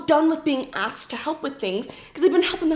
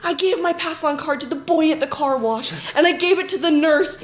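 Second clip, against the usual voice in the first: put-on high, eager old-timer; excited elderly members; 4 semitones higher than usual. Ninety-year-olds reporting to the sisters, eagerly excited. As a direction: pipe up proudly, calling for attention. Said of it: "ninety-year-olds coming up to us on Sundays".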